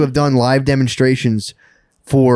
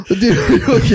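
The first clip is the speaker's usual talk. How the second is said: high, breathless laughter; laughing; 12 semitones higher than usual. Real, not acted. Telling a friend about the collapse, laughing hard. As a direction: crack up mid-sentence.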